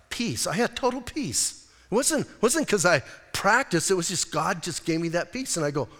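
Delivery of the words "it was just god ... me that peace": ordinary delivery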